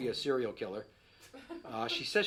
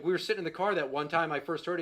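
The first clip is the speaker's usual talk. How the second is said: usual speaking voice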